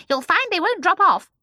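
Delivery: high, loud, bossy little woman; brisk old shopkeeper. Acted. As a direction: order them in a high, eager voice. Old shopkeeper reassures customers.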